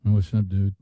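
lazy podcast host; mocking podcast bros. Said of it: "this is how they talk".

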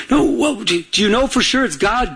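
ordinary talk, nothing marked

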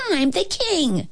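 high, self-important royal whine; shouting king. A narrator's reading. King pulling rank on the cook, high mock shout. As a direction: assert rank in a high, spoilt voice. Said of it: "he shouted".

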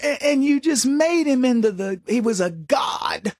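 ordinary talk

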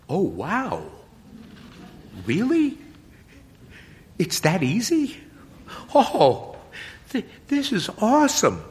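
can't believe his luck; thrilled boy. Acted. Boy delighted the priest lets him quit, high and excited.

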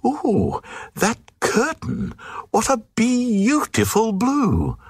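high, fluting queen swooning over a curtain; gushing queen. Acted. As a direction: coo it like a dazzled high-born lady. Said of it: "And the queen said".

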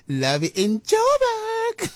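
very high, very slow, stretched imitation of "Love in Joburg"; imitating the caller. Host playfully mimicking a caller's sign-off, high and slow. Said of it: "I love the way she said".

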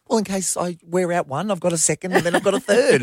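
imitating mum. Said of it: "She said".

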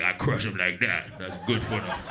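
low-pitched, offhand reply; imitated homeless man. Homeless can crusher explaining his workout, low and casual. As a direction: drop the voice low for a casual boast. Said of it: "He's like".

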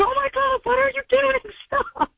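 high-pitched, panicked mock yell at the swerving car; alarmed yell. Other driver yelling at swerving teen, panicked high yell. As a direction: squeal it like a startled driver.

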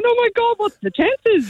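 high-pitched retold squeal of astonishment; amazed exclamation. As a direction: relive the giddy shock of a chance reunion. Acts out cousins' astonished reunion.

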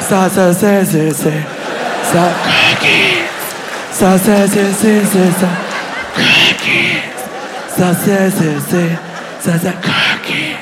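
sibilant elf chant with whispered bursts; hushed nonsense Elvish chorus; elves. Acted. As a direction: murmur mock Elvish, stretching the last word in a whisper. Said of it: "like, three elves".

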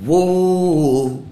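a drawn-out stage "Whoa" sung into the crowd; singer's mic call. Retold or imitated. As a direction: call out like a showman working the audience.

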